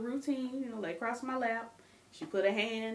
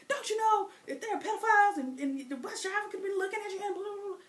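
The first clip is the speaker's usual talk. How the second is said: much higher, louder worried grandmother; scolding grandma; 12 semitones higher, 5 dB louder, faster than usual. Retold or imitated. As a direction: lecture her in a rush.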